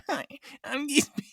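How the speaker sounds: high, small mocking imitation of a reporter; Geese Beep. Co-host imitating the reporter caught by her mother, high mocking voice. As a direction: mimic her greeting high and soft. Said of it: "by her mother walking in".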